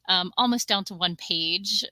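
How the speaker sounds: usual speaking voice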